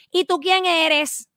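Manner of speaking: high, slow, haughty sneer; mocking imitation. Imitating the legislator's scornful question. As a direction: mimic her dismissive swagger. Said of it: "Así, remeneándose".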